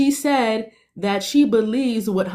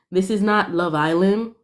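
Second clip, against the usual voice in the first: ordinary delivery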